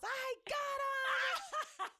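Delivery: very high, drawn-out triumphant shout through laughter; retold yell. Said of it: "I hear him yell through the house".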